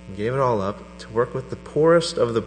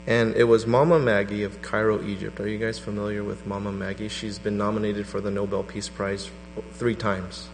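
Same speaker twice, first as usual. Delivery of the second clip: ordinary talk, nothing marked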